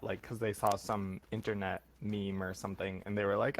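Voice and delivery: normal talking voice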